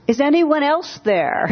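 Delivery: high-pitched comic plea; man calling out. Retold or imitated. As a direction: call out hopefully for another rescuer, hammed up. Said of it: "The man says".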